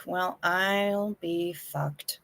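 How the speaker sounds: slow, breathless whispered curse; a stunned, slow whisper. Real, not acted. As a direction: mutter it under your breath in disbelief. Reacting to TB returning.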